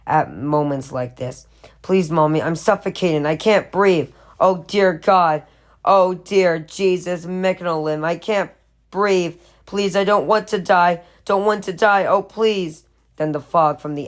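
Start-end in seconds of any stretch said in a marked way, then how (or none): 1.8-12.8 panicked pleading. Acted. Boy pleads with his mother during an asthma attack, panicked and higher. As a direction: gasp out each plea as the air runs out.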